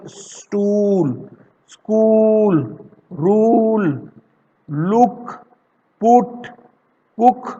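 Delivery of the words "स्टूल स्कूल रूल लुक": स्टूल, स्कूल, रूल, लुक spelt out slowly and clearly; slow word model. Shown as a demonstration. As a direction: model each word for the class. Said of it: "देखो". (हर शब्द धीरे और साफ़ बोलकर दिखाना)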